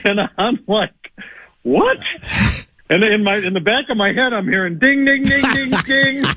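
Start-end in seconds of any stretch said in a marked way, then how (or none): 1.6-2.0 high, startled yelp of surprise; a sudden disbelieving squeak; shocked what. Retold or imitated. Shocked reaction in retold bar argument. 5.0-6.2 high chiming ding ding; imitated bell. Imitating warning bell in head.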